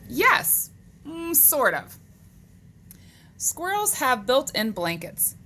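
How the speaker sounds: animated, measured picture-book reading; read-aloud to children. Acted. Teacher reading animal facts to boys and girls, animated read-aloud. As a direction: read it out to young kids, lifting the questions.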